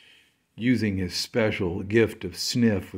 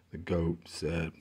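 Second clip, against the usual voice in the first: usual speaking voice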